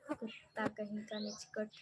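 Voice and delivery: soft scornful mutter; muttered insult. Real, not acted. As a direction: curse him quietly under your breath.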